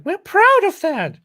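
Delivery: high, loud and slow; high loud squeal. Shown as a demonstration. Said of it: "You didn't say it right. It's like".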